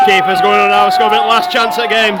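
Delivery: loud and high, urgent over crowd noise; tense excited shouting. Real, not acted. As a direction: shout it with last-chance nerves. Tense final moments of the match.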